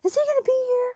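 high, fast, breathless fangirl squeal; starstruck fan. Pretend. Mocking fans asking if Brad will come, high and fast. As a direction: rush it out like a thrilled fan.

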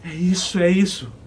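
high, loud eureka; excited realization. Acted. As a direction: burst out with discovery. Sudden realization. (euforia da descoberta)

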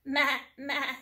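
loud bleat of a goat. Acted. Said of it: "said the goat".

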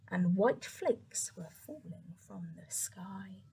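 slow, hushed, wondrous; slow whispered wonder. A narrator's reading. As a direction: whisper it like falling snow. Narrator describes falling snow.

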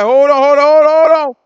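sinking Peter crying out in panic; panicked plea. Retold or imitated. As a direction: yell it like you're going under.

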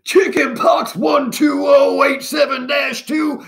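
very high, slow shouted report; strained high military bark; barking commander. A narrator's reading. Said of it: "barked Commander Flem".

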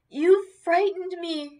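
high, drawn-out moan of distress; high, slow, wounded moan. A narrator's reading. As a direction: moan it slowly, pitched high. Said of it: "she moaned".

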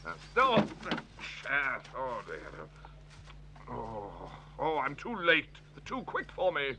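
low, very soft grumbling to himself; dejected muttering. Acted. Hector alone, beaten at tag, dejected muttering. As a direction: mutter low and slow, giving up. Hector searching, too late.

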